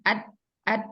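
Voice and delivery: "Ad" stopped dead on the d; sukun on dal. Shown as a demonstration. Teacher testing whether dal flows, abruptly stopped "ad". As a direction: cut "ad" off with no flow of sound. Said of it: "Coba disukunkan".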